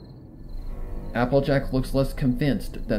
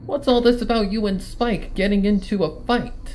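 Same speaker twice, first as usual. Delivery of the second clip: higher, pushy tomboy voice; brash Rainbow; 8 semitones higher than usual. Acted. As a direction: get in her face.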